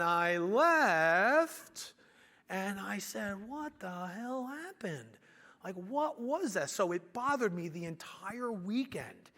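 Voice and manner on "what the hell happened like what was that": slow, high, hushed disbelief; stunned bewilderment. Retold or imitated. Young contractor leaving with the check, stunned slow retelling. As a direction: re-enact dazed shock, drawn out softly.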